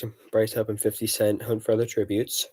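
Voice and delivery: ordinary delivery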